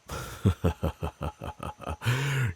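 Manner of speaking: short amused laugh; a knowing chuckle. Acted. Reacting to elf's story.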